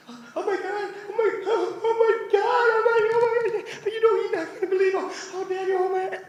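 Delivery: high, loud hysterical babble of an overexcited agent; mock breathless excitement. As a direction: gush as if too worked up to breathe. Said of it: "this lady can barely breathe".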